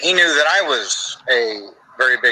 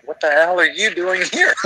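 incredulous, laughing question; recalling the guitarist at breakfast, laughing; laughing disbelief; 4 semitones higher than usual. Retold or imitated. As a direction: throw it out laughing, amazed. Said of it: "I'm like".

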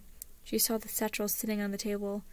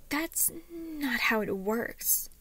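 higher, slow, drawn-out protest; huffy objection; 6 semitones higher, much slower than usual. A narrator's reading. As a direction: object in a huff, stretching the words. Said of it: "Harriet huffed".

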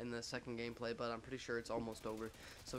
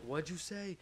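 high-pitched challenge, imitating her; put-on high, confrontational question; mock indignant girl; 6 semitones higher, slower than usual. Angry girl challenging him, retold.

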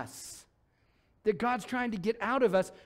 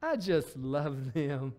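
tender adoring; 4 semitones lower, slower than usual. Pretend. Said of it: "God's looking down going".

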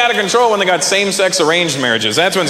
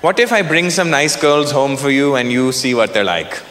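low, measured mom voice with long pauses; mock-grave nagging mother; Indian mother; 5 semitones lower, 3 dB quieter than usual. Mom urges her son to marry, low measured nagging. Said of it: "she goes".

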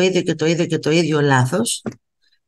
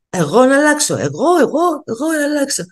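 high, indignant mimicry of a narcissist; mocking narcissist; 8 semitones higher than usual. A vlogger mocks a narcissist refusing to change, high and indignant. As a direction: parody the self-righteous refusal to change. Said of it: "θα σου λένε".

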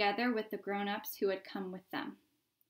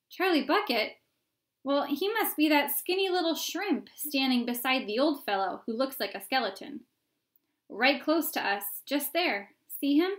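crowd onlooker; 4 semitones higher, 6 dB louder than usual. Acted.

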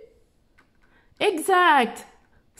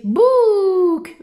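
loud cheery reveal; 7 semitones higher, 7 dB louder than usual. Shown as a demonstration. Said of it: "B, le O".

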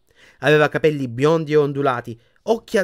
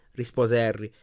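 ordinary delivery

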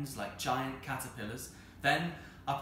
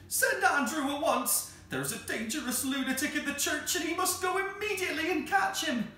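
very high, loud hysterical orders; panicked shrieking; 6 dB louder than usual. Acted. Wife orders maid to send Andrew after lunatic, hysterical. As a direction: keep it up at a frantic high pitch.

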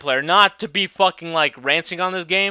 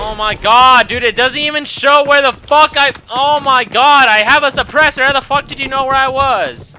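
loud, raised-pitch indignant rant; exasperated outburst; 5 semitones higher, 6 dB louder than usual. Real, not acted. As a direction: protest loudly in disbelief at the game. Gamer outraged at being found.

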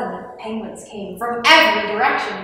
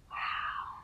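very soft, whispered wow; barely voiced, awed exclamation; hushed amazement; 16 dB quieter than usual. A narrator's reading. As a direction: whisper it in awe. Amazed at the found machine.

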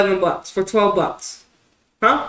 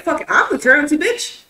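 defiant bravado; 7 semitones higher, 5 dB louder than usual. Retold or imitated. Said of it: "I'm just like".

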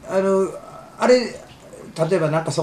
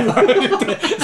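light high laugh; 6 semitones higher than usual. Real, not acted. Laughing at the story.

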